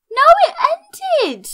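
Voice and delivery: high, loud wail of protest; dismayed cry. Real, not acted. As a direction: cry out as you just miss it. Event ended before she joined.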